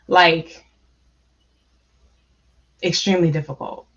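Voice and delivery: long pauses, choosing words so as not to cry; holding back tears. Real, not acted. Panelist answering on her hardest two years, trying not to cry. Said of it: "I'm talking slow 'cause I'm trying not to cry".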